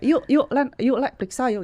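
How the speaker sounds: quick excited plea to come along; excited urging. Retold or imitated. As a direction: nudge a friend along with eager, repeated calls. Excitedly urging friend to check.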